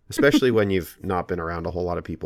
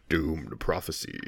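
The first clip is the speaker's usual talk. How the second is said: slow, quieter show title; announcer voice; 3 semitones lower, 5 dB quieter, slower than usual. Shown as a demonstration. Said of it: "Was that better?".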